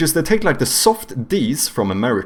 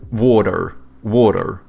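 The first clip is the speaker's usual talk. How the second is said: American "Water", tapped T with an R at the end; American "water", wah-der with a hard R; American accent; 3 semitones lower, 3 dB louder than usual. Shown as a demonstration. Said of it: "so they go like".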